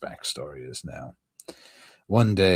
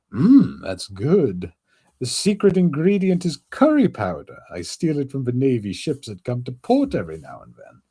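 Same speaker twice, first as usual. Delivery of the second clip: raised goofy voice; lively high character voice; high skeleton voice; 6 semitones higher, 4 dB louder than usual. Acted. Voices Brook.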